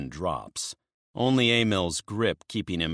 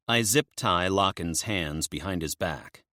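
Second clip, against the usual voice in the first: ordinary delivery, nothing marked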